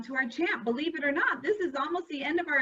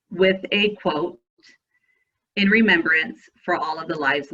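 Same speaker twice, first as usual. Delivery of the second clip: lower, louder, measured memorial reading; solemn quote reading; 3 semitones lower, 9 dB louder than usual. Acted. Reads a remembrance quote.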